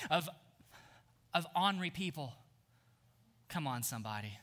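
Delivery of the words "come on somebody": ordinary talk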